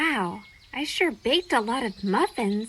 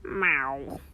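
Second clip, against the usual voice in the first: deep, grumbling cat meow; a hungry cat's sulky growl of a meow; a cranky cat demanding food; grumpy low meow; 11 semitones lower, 6 dB quieter than usual. Acted. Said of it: "You sound a little hangry".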